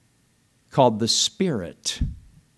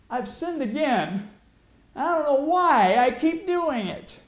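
higher, slow whining prayer; whiny confession; 11 semitones higher than usual. Retold or imitated. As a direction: whimper an excuse to the Lord. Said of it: "I used to do this".

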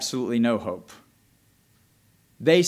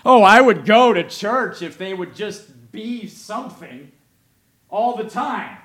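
higher, louder whiny mock excuse; mock churchgoer excuse; 6 semitones higher, 8 dB louder than usual. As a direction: mimic a lukewarm churchgoer's excuse, hammed-up.

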